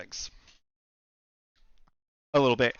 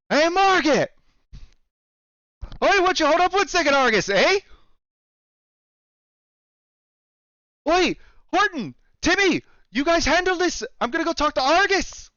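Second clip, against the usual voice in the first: high-pitched, hammed-up Canadian shopkeeper calling out; Canadian accent; 13 semitones higher, 4 dB louder than usual. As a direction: call across the store in a broad Canadian accent. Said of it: "I'm butchering the accent".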